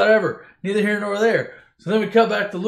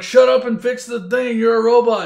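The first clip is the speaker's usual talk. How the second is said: loud, raised-pitch mock scolding; exasperated Han Solo; 5 semitones higher, 8 dB louder than usual. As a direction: snap loudly at a useless sidekick. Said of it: "And Han's like".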